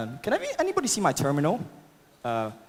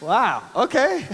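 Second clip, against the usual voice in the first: real amazement; 8 semitones higher, 4 dB louder, slower than usual. Real, not acted. Helicopter flies over the stage.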